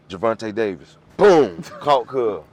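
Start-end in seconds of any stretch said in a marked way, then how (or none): 1.2-1.6 loud, drawn-out spoken boom; punch sound effect. Retold or imitated. Storyteller imitating mother's knockout punch, loud and drawn out. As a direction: act out the blow with a big boom.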